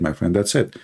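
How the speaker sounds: ordinary talk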